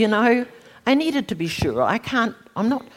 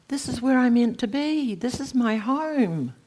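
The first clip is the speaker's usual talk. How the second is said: raised, ringing cry of arrival; overjoyed homecoming; 6 semitones higher, slower than usual. Retold or imitated. Arriving in the vast hall, she cries out in wonder. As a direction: let the joy of coming home lift the voice.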